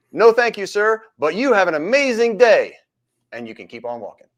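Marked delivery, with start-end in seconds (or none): 0.1-2.8 a bright, higher, firm but courteous no-thanks; confident polite refusal. Pretend. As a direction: decline politely with total confidence. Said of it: "You can say with a hundred percent confidence".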